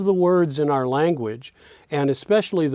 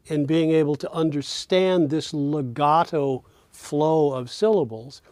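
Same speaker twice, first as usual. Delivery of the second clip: ordinary talk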